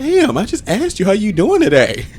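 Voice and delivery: high, slow injured protest; mock wounded innocence. Imitating partner defending her greeting, high wounded innocence. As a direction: draw it out high and hurt.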